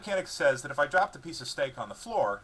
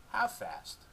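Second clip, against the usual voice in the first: higher dog voice, hopeful; 11 semitones higher than usual. A narrator's reading. Dog asking owner hopefully, hopeful dog voice. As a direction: ask eagerly like a pup. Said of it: "looking hopeful".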